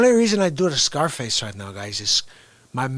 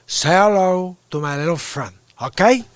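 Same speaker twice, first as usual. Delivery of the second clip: Tony Montana growl, louder; Scarface Cuban accent; 3 semitones higher, 4 dB louder than usual. Retold or imitated. Streamer quoting Scarface to his chat, in a Cuban accent. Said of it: "my favorite line in Scarface".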